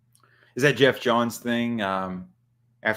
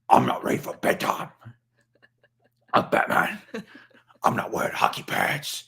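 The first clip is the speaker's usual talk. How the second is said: voiceless rasping superhero growl; Batman; faster than usual. Acted. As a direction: growl stubbornly like a sulking masked hero. Plays Batman refusing bedtime.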